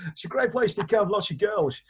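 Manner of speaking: hammed-up comic Icelandic accent; put-on Icelandic accent. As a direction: play Carl with a silly, high Icelandic accent. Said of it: "I don't know why I'm doing that accent".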